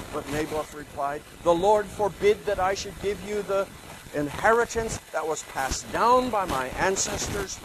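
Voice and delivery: reading scripture aloud to the class; measured storyteller read; Bible story reading. Acted. Lecturer reading the Naboth story to students.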